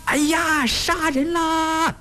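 very high, drawn-out scream of alarm; wailing panicked cry. A narrator's reading. Villagers outside cry murder in the chaos, wailing. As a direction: wail it out like a terrified crowd. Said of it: "鬼哭狼嚎的乱嚷".

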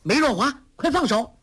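high, sharp shouted command; a girl commanding a villain to let go; shouted order. A narrator's reading. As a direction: bark the name and the order. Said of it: "向梅超风喝道".

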